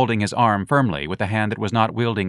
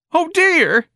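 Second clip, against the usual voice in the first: very high loud alarmed shriek; 6 dB louder than usual. Acted. Young man stabbed with a cutlass, high shriek. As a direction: squeal in alarm. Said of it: "the young man shrieked".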